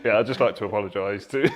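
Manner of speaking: loud apology through laughter; laughing. Real, not acted. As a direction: apologise while cracking up. Laughing apology after bad accent.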